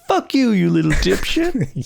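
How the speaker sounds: high squeaky mock insult; broken adolescent voice. Host mimics young John swearing at a guy, broken voice. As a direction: hurl it in a cracked teen squeal. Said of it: "His voice is so broken".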